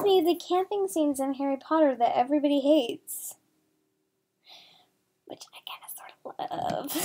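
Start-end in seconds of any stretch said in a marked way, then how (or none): 5.3-6.9 sheepish fast whisper. Real, not acted. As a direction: confess it under your breath. Sheepish confession aside.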